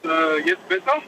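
distant, faint speakerphone question; car hands-free phone. Real, not acted. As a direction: far from the car mic. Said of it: "Du bist halt weit entfernt".

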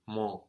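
"Mon" with the N swallowed into a nasal vowel. Shown as a demonstration. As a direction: let "Mon" end in a nasal o, no N. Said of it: "make it more nasal".

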